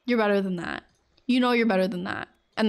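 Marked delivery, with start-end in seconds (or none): none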